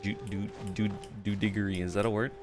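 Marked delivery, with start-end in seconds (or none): none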